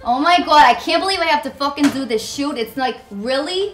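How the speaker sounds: put-on a light, brash New York girl accent; tough New York girl. Model doing her tough New York character, mildly exasperated New York accent. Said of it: "New York girl, she's tough".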